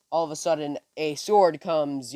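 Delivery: ordinary delivery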